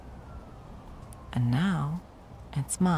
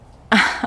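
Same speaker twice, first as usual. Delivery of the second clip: mocking laugh; 9 dB louder than usual. Taunting captive ex.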